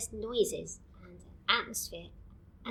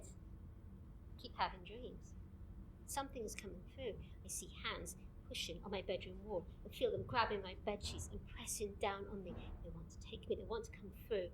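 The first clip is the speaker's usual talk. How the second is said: softly confiding a nightmare; hushed confession; 7 dB quieter than usual. Acted. Recorder confides frightening dreams to colleague, hushed.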